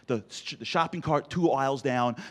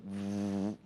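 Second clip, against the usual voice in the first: window noise; 5 semitones lower, 8 dB quieter than usual. Retold or imitated. Imitating window going up.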